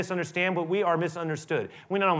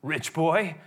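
sneering taunt. Retold or imitated. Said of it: "Took a little liberty there".

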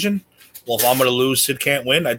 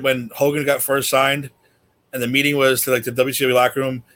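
ordinary talk, nothing marked

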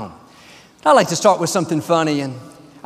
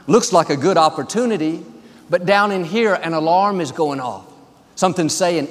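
ordinary talk

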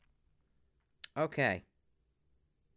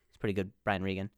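usual speaking voice

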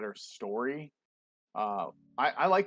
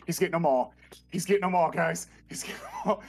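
high, loud fanboy excitement; breathless fanboy hype, acted out; retold excited freak-out; 6 semitones higher, 5 dB louder, faster than usual. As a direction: replay freaking out, each repeat bursting out. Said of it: "I'm, like, freaking out".

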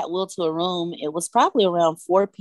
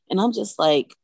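ordinary delivery

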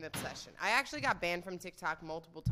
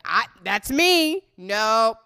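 mock dad calling it out loudly; imitating dad; 10 dB louder than usual. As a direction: play a dad loudly objecting. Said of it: "I can hear my dad right now".

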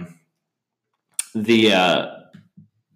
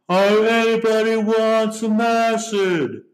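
much higher, very slow put-on voice; imitated stoner cameo; 14 semitones higher, much slower than usual. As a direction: stretch it out like a spaced-out hippie. Imitating cameo character offering acid.

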